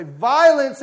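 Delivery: loud, high, drawn-out cry; crying out in prayer. A narrator's reading. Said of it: "cry to you in this world and say".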